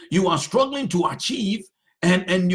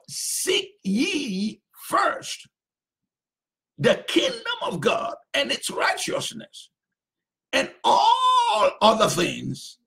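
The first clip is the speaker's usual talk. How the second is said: slow emphatic scripture; 8 semitones higher, slower than usual. Acted. Preacher dwells on scripture.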